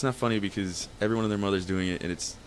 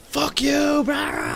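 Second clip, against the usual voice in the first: high, loud mock shout into gibberish; imitated screaming; 5 dB louder, much slower than usual. Brother refusing to sit down, imitated scream. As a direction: bellow back at being told to relax. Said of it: "screaming the top of his lungs".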